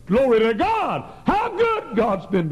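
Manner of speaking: high, loud praise shout; fervent praise. Acted. Preacher praising God before the congregation, high and loud. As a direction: burst out in praise.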